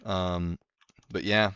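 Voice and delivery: ordinary talk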